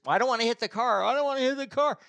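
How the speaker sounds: high-pitched panicky whine; hammed-up wailing refusal; mock crying. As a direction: imitate a tearful driver protesting. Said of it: "she's big time crying now".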